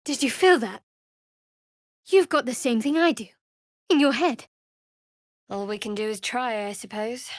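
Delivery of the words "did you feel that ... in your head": alarmed. Acted. Shaken after the tadpole's pull.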